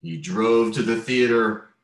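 ordinary delivery

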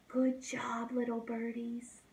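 soft hushed whisper. Real, not acted. Teacher whispers to the sleeping little birdies. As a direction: whisper so they stay asleep.

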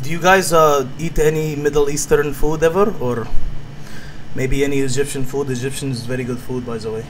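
put-on low, accented broken English; put-on foreign accent. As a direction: make friendly small talk in a foreign accent.

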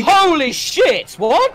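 high, loud stunned outburst; shocked shout. Real, not acted. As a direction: yell it, totally floored. Shocked by a big donation.

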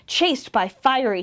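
usual speaking voice

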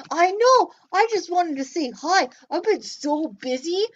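a bouncy little puppet popping up. Pretend. Puppet Joe talks.

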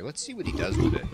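player-to-tiger mock thought, jumped up loud; tiger's inner voice. Narrating gamer voices the tiger, lightly mocking. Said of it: "He's like".

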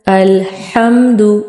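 अल्हम्दु slowly, breath before the ha; a careful, drawn-out अल्हम्दु; slow model word. Shown as a demonstration. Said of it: "सबसे पहला word है अल्हम्दु".

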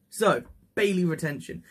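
normal talking voice

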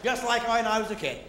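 normal talking voice

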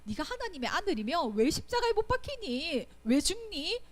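mock him as the crowd did; scornful taunt. People insulting Jesus on the cross, sneering and rising. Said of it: "사람들이 욕하고 비난하고".

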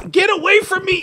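high, loud frantic yell; alarm shout. Real, not acted. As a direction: yell to shake off an attacker. Attacked in game.

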